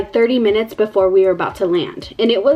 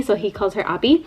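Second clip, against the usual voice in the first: normal talking voice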